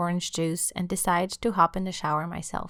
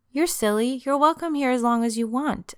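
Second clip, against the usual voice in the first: put-on higher, brighter pleading girlfriend; playful insistence, higher and a bit louder; eager girlfriend; 6 semitones higher, 3 dB louder than usual. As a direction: coax him to stay, lifting your voice. Catherine insists he stay.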